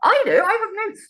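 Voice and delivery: high-pitched comic self-defence; defensive protest. Real, not acted. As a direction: argue back, voice pushed up high. Defends himself against co-host's teasing.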